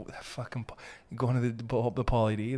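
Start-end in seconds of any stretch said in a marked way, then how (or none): none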